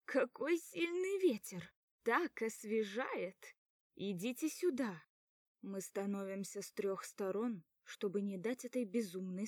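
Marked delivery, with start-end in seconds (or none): 0.0-5.0 giddy cry. A narrator's reading. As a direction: shout into the wind. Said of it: "и кричит".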